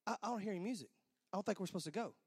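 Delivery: soft, hesitant objection; hushed protest. Retold or imitated. Groom telling his dad there is no music yet, hushed. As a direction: object under your breath.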